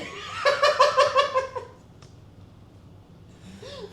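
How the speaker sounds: high, loud burst of laughter; long helpless laughing fit, high and loud; cracking up. Real, not acted. Host cracks up over the uniform hat, helpless high laughter.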